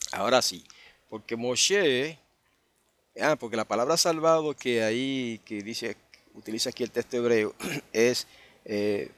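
ordinary talk

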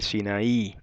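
Sinaí stressed on its last vowel, Greek style; stress on final I. Shown as a demonstration. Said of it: "con acento en la I".

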